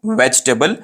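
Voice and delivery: "वेजिटेबल" as compact VECH-tuh-bul; no middle "i", reduced ending in "वेजिटेबल"; actual pronunciation. Shown as a demonstration. Teacher gives the actual form, shortened "वेजिटेबल". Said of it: "सही pronunciation है".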